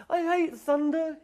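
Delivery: very high, loud, slow whimper. Acted. The monster frightened of thunder, whimpering high and slow. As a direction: whimper it clinging in fear. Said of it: "he whimpered".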